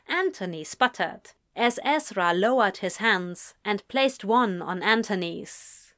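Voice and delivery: ordinary delivery, nothing marked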